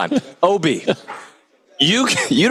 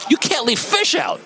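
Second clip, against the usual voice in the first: high pitched outraged cry; outraged high exclamation; 11 semitones higher than usual. Real, not acted. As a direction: blurt it out high in disgust. Disgusted rant about buffet food.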